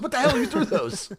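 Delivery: hammed-up disbelief with a laugh at the end; mock outrage. Retelling a character learning about the caltrops, mock outraged. Acted outburst in retold scene.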